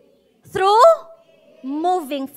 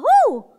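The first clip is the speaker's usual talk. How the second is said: higher cheerful woo; 9 semitones higher, 6 dB louder than usual. Shown as a demonstration. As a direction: let out a bright woo. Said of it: "Sabi mo nga". (masiglang woo)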